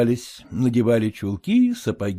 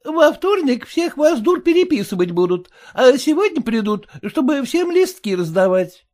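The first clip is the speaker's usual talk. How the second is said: high, sour, fretful wife voice; high complaining wife; sour merchant's wife; 13 semitones higher, 5 dB louder than usual. A narrator's reading. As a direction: whine it like a sour housewife. Mother voiced.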